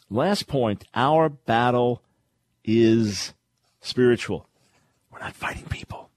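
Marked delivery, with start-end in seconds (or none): 5.1-6.1 breathy whisper, drawing them in. Real, not acted. Preacher whispering the point to his listeners. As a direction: hush it like a secret.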